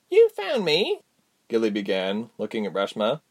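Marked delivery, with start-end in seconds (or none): 0.1-1.0 put-on little girl voice.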